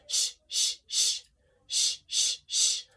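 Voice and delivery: quiet unvoiced sh pulses; soft sh pulses. Shown as a demonstration. Coach leading two rounds of sh, soft pulses. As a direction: push out short puffs of sh. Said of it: "And again".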